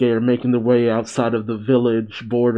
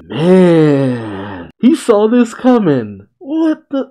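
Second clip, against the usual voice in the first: alarmed excitement; 5 semitones higher, much slower than usual. Real, not acted. Alarmed reaction to villain.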